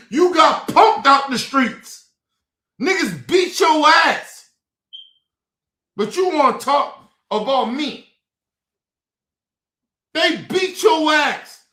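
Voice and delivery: shouting loud and high, slow and heavy; furious shout. Real, not acted. Ranting at those who mock him, furious shout. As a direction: yell each word at the doubters. Angry rant at critics.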